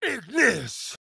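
commanding spell cry; spell incantation. Acted. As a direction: hurl the fire word out. Casting a fire spell.

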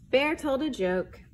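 usual speaking voice

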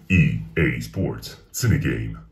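deep voice. Pretend. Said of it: "Can you say".

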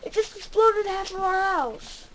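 high, loud wail easing off in volume; dismayed cry. Real, not acted. Player tells viewers the house exploded, high dismayed cry. House just blown up in game.

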